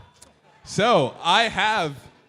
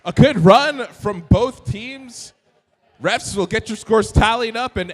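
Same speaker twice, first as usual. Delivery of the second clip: loud announcement; 5 semitones higher, 8 dB louder than usual. Acted. Announcing to arena.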